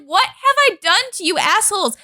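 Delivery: lightly voiced high indignant Anakin; mock Anakin outrage. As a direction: play a touch of whiny outrage. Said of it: "He's like".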